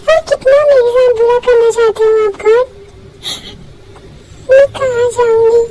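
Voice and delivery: sobbing, pleading then asking God why; crying despair. Acted. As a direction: cry it out, broken and begging. Locked out, crying alone. (रोती हुई, टूटी आवाज़ में)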